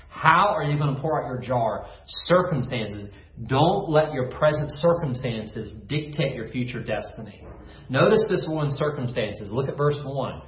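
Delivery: ordinary delivery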